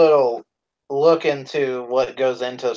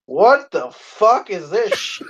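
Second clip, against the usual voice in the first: put-on slow, higher-pitched drunken bewilderment; mock-drunk slow outburst; drunk friend; 5 semitones higher, slower than usual. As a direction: imitate a drunk pal, drawing out the disbelief. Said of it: "you would have thought he drank ten gallons of fucking vodka".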